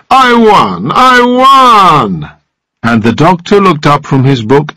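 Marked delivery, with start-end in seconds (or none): 0.1-2.3 high-pitched exultant crowing; triumphant cry. A narrator's reading. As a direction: crow your victory, stretching the last word out.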